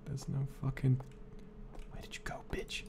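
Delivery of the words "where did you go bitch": unvoiced hissed whisper. Real, not acted. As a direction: whisper it, searching the dark. Whispering while searching.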